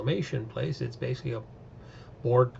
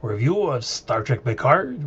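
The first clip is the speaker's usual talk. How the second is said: loud, slow, hammed-up French accent; outrageous French accent; 7 dB louder than usual. A YouTube host greets viewers in a deliberately bad French accent. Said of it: "please excuse my outrageous French accent".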